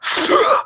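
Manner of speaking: loud sudden intake of breath; sharp revival gasp. Acted. Patient coming back to life mid-surgery, loud mock gasp.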